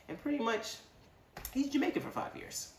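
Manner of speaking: ordinary talk, nothing marked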